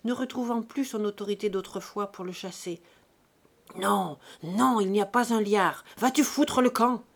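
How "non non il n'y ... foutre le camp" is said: put-on loud, strained old man's protest; worn-out father shouting angrily; broken old voice. A narrator's reading. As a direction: try to throw him out, voice cracked. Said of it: "la voix cassée".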